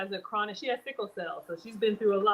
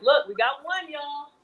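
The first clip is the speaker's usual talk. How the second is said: a bright, louder call to the viewers; excited call-out; 6 semitones higher, 4 dB louder than usual. Real, not acted. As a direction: announce the new comment with glee. Excited about a viewer comment.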